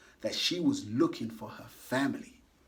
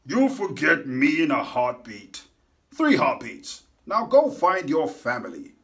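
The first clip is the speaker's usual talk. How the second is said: louder gruff-ish farewell; Hank's voice; 7 dB louder than usual. A narrator's reading. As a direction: push her off with a loud goodbye. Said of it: "said Hank".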